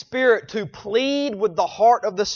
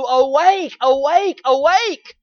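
raised, insistent call, climbing with each cry; 4 semitones higher, slower than usual. Acted. Voice of love startling a sinner, crying out. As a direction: urge a sleeper up, pitch rising on each repeat. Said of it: "a voice of love crying out to".

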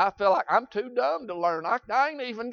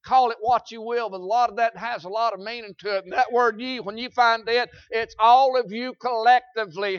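usual speaking voice

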